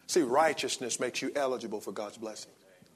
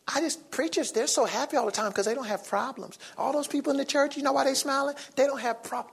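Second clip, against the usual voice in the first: mocking skeptic; 10 semitones higher than usual. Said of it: "I was telling somebody recently".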